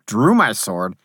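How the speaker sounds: usual speaking voice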